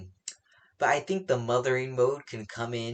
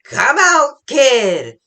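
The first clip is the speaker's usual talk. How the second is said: loud, high-pitched imitated yell; Big Mom; 13 semitones higher, 15 dB louder, much slower than usual. Big Mom yelling for the kid to come out, loud. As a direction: holler it out as a villain's summons. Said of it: "who is yelling".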